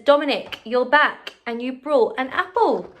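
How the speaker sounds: delighted new owner. Acted.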